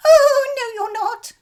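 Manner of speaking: high, loud goat cry; high loud goat protest. A narrator's reading. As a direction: squeal it back at him. Goat answers troll.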